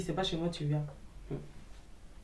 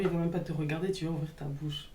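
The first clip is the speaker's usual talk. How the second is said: ordinary talk, nothing marked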